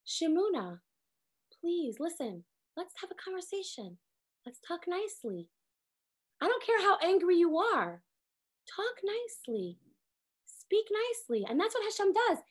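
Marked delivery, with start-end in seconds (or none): 0.1-11.4 put-on calm a child down without raising your voice; soft and gentle. Hashem speaking gently to angry Miriam and Aaron, soft and coaxing. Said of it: "he spoke to them softly".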